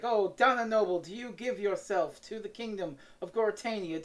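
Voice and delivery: normal talking voice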